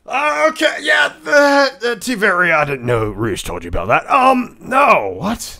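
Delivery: high, loud flustered cry; panicked outburst. Real, not acted. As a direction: caught out and panicking.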